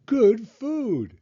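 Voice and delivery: put-on high, loud child's voice; proud little girl. A narrator's reading. Little girl proudly presenting junk food to her father, high and loud. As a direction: beam as you show it off. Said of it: "Taya said".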